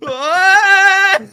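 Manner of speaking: a sudden hammed-up shriek; mock pained yelp. Imitating a Russian tank crewman after a hit, with a loud mock yelp. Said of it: "They go".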